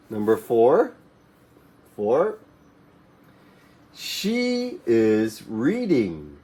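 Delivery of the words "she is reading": slow, careful model sentence; teacher modelling slowly; slow model reading. Shown as a demonstration. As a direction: stretch each word for the class to copy. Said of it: "model sentence for class".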